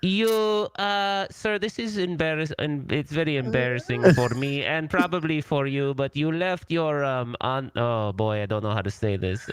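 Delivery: high, halting, fake-embarrassed clerk voice; disguised caller voice. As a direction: stall and squirm like a hotel clerk who hates this call. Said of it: "such a good job disguising it".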